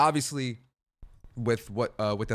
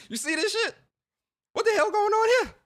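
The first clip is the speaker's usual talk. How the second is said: high-pitched, hammed-up outrage; mock alarmed disbelief; 5 dB louder than usual. Retelling a call to his buddy about crazy news, mock alarm. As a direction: squeal it in comic disbelief.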